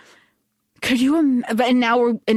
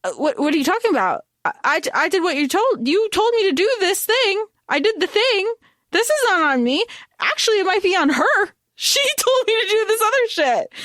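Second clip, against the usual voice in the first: rapid, rising higher and higher in protest; flustered disbelief; 8 semitones higher, faster than usual. Retold or imitated. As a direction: blurt it out fast, defensive and baffled, breaking into a laugh. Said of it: "Diem's first reaction is".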